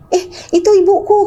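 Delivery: put-on high pitched confused old man; imitating father. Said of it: "papaku bilang kayak gini".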